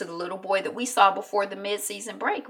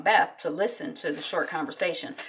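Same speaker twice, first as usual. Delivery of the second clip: normal talking voice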